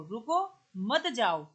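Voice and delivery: "रुको" rising, short pause, then "मत जाओ"; रुको के बाद विराम. Shown as a demonstration. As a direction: stop after "रुको", keep "मत जाओ" together. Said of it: "शब्द वही है". ("रुको" पर रुककर "मत जाओ")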